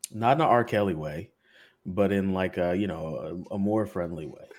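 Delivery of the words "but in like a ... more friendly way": normal talking voice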